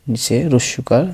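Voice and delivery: ordinary talk, nothing marked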